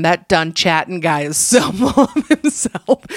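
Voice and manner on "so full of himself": cracking up on the way through a quote; amused quote dissolving into giggles; laughing. Retold or imitated. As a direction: read the quote, breaking into laughter near the end. Laughs reading husband's mistaken complaint.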